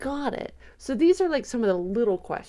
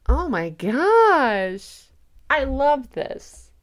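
loud, slow, drawn-out delight; thrilled gushing; 7 semitones higher, 8 dB louder, much slower than usual. Real, not acted. Fan thrilled by her trailer theory, loud drawn-out gushing. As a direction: stretch it out loudly, overjoyed.

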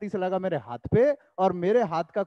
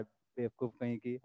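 muttered insult; 8 semitones lower, 12 dB quieter, slower than usual. Real, not acted. Muttered insult after retold scene.

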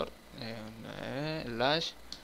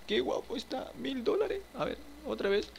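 much higher, squeaky put-on voice; silly squeaky voice; 11 semitones higher than usual. Gamer gushing at a pricey piece in a squeaky voice. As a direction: gush over the furniture like a cartoon.

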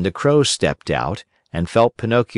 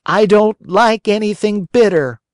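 put-on much higher, louder childish whine; whiny puppet boy; 12 semitones higher, 4 dB louder than usual. A narrator's reading. Pinocchio refuses the fairy's bitter medicine, whining.

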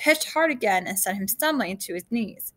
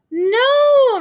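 long, very high, loud cry; shouted; 11 dB louder than usual. A narrator's reading. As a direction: scream it in horror after someone. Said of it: "Vanessa shouted".

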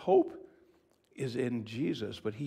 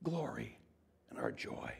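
rejoicing declaration; 5 semitones higher, 4 dB louder than usual. Acted. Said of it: "rejoices and says".

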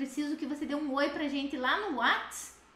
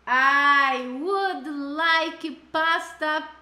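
"I would like water, please" very slow and louder; slow loud model; 3 semitones higher, 6 dB louder, much slower than usual. Shown as a demonstration. Teacher modeling the answer for students, slow and loud. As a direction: say it slowly for the class to copy. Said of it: "Macarrão".

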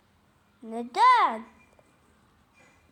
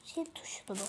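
whisper; 7 semitones lower, 11 dB quieter than usual. Real, not acted. Whispered aside to self.

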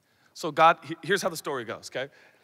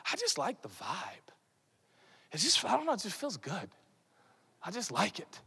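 breathy hushed whisper; dreamy whisper; 3 semitones lower, 11 dB quieter than usual. Retold or imitated. Preacher mimics a teen at worship, hushed whisper. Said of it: "you're like".

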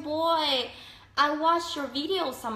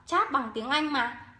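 normal talking voice

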